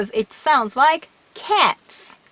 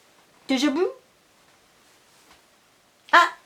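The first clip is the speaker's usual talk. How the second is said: acted Japanese exchange; 3 semitones higher, much faster than usual. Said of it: "If you say".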